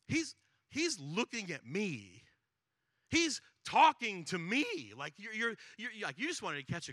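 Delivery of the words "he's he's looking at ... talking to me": slow, rising higher and louder; growing alarm. Retold or imitated. Zacchaeus realising Jesus is coming for him, slow rising alarm. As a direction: panic creeping in as he realises. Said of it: "you're thinking".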